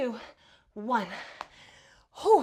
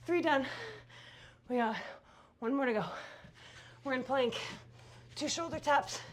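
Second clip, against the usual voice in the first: ordinary delivery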